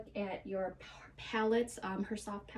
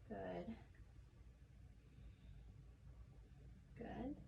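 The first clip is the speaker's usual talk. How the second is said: quiet; 11 dB quieter than usual. Real, not acted. Quiet instructions during stethoscope exam.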